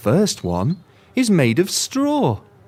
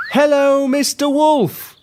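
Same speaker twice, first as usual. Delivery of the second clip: calling out; 10 semitones higher, slower than usual. Shown as a demonstration. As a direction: call out across the field. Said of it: "Will you help me to shout to him?".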